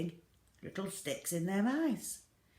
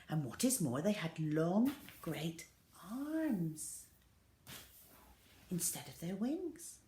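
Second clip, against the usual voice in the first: usual speaking voice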